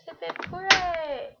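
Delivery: high, slow, drawn-out cheer; hooray cheer. Real, not acted. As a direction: cheer it out, celebrating. Celebrating getting the answer.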